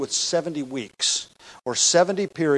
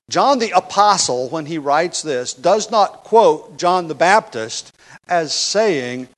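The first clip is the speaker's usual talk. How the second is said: ordinary talk, nothing marked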